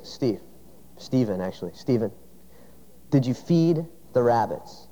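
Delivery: low, quiet stern father's question; stern dad. Retold or imitated. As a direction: press the boy quietly, like a father who already knows.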